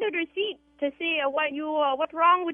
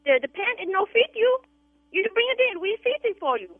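put-on broken English, Vietnamese accent; 6 semitones higher than usual. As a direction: prank call cleaner, Vietnamese accent.

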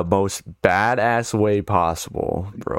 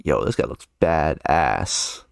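slow, lower awed aside; impressed reaction; 5 semitones lower, slower than usual. Retold or imitated. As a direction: draw it out, impressed. Quotes own awed reaction.

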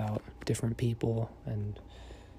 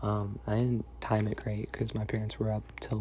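normal talking voice

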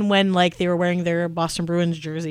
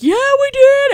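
very high, loud proud whoop; retold cheering shout; 9 dB louder, slower than usual. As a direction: imitate an excited fan yelling back. Retells fans yelling back.

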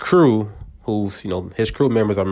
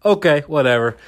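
high, breezy brush-off; unbothered; 10 semitones higher, 3 dB louder than usual. Retold or imitated. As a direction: shrug it off, not bothered. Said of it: "she's super cool".

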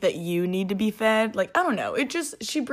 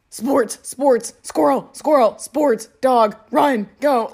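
loud, higher, punched single words; excitable boy dog; 6 semitones higher, 9 dB louder, slower than usual. Acted. Boy dog spotting a squirrel, excitable athletic shouts. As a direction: shout each word like a hyper sporty dog. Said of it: "Boy dogs, on the other hand".

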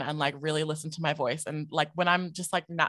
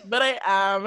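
higher, louder insistence; mock protest; 4 semitones higher, 6 dB louder than usual. Guest replays defending her origin, mock protesting. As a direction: push back in playful indignation.

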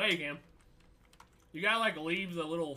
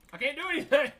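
frustrated yell; 11 semitones higher, 10 dB louder than usual. Real, not acted. Frustrated at lag.

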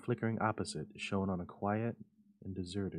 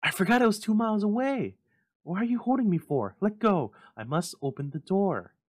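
high, loud frantic pleas; frantic pleading; 12 semitones higher, 11 dB louder than usual. A narrator's reading. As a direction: struggle and beg to get free. Frantic mother fights husband.

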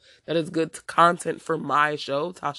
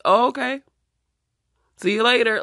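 sarcastic dismissal; 7 semitones higher, 5 dB louder than usual. Real, not acted. Dismissing guests who unfollowed.

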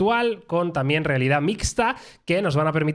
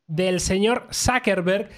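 ordinary delivery

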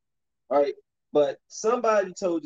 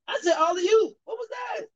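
frustrated yell. Real, not acted. Gamer yelling at his teammate for ignoring the alley-oop, frustrated shout. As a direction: pitch way up, shouting at the screen.

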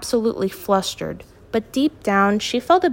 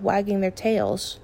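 plain reading voice

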